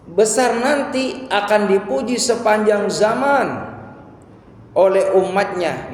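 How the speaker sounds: high, slow, drawn-out preaching; stretched, impassioned pulpit delivery; emphatic proclamation. Real, not acted. Preacher proclaims the Prophet's future praise.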